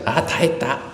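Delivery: a child's holler, re-enacted at talking volume; imitated gleeful yell. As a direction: call it out high, imitating your childhood self. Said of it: "소리를 지르죠".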